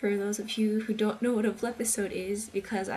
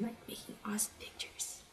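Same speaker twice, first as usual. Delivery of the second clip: much softer, whispered words; whispering; 13 dB quieter than usual. Real, not acted. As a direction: breathe it out as a whisper. Trailing whispered aside.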